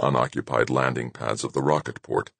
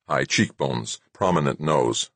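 usual speaking voice

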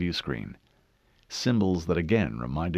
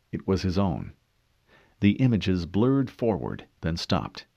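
ordinary delivery, nothing marked

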